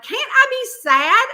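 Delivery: high, loud plea in self-defense; retold exasperated outburst; indignant protest. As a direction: protest as if you're being picked on. Acted protest to her family.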